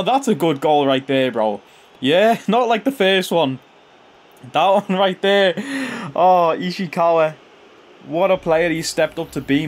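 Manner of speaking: usual speaking voice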